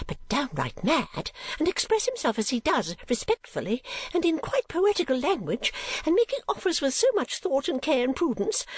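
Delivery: put-on a scandalised old lady, very high; Mrs Nickleby.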